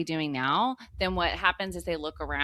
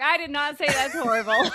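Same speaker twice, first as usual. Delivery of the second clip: protests through real laughter; laughing denial; 10 semitones higher than usual. Real, not acted. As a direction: laugh it off while objecting.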